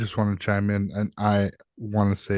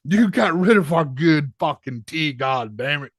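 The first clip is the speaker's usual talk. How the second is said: mock angry colonist voice; 6 semitones higher than usual. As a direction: rant like an offended colonist. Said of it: "why do they sound like me".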